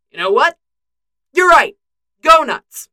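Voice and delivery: exasperated surrender. Acted. Bucky giving up arguing with Clint, loudly exasperated. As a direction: give in loudly, one burst at a time.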